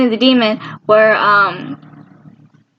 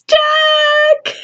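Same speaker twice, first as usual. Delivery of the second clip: a high cry of his name; anguished cry. Retold or imitated. Fan crying out at the screen for Jack. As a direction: wail the name out in distress. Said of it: "every time I see Jack, I'm like".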